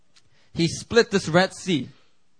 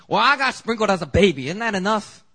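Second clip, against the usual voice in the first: higher, louder whiny congregant voice; mimicking congregant; 6 semitones higher, 5 dB louder than usual. Preacher mimics a member at membership class, high and whiny. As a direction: play the doubtful member, pitched up and pushy. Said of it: "some of y'all are like".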